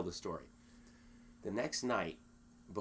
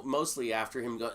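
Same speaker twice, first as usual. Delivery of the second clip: ordinary talk, nothing marked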